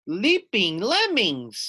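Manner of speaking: slow, high snuffled cry; snuffling. Acted. Harry Clary snuffles at the news. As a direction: snuffle it out in worry. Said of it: "snuffled Harry Clary".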